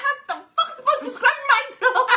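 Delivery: disbelieving laugh, high; laughing high outburst. Retold or imitated. Retelling the throat advice to her friend, laughing. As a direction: squeal it through laughter.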